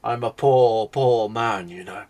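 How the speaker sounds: mock-pitiful haggler, words stretched out; pleading merchant. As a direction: plead poverty slowly and loudly, lightly in character. GM acts merchant pleading poverty.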